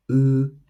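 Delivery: U held high and level; first tone. Shown as a demonstration. Teacher modelling tones for repetition, U high and flat. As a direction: keep U steady at a high pitch. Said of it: "which is high and flat".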